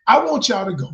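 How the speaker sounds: ordinary talk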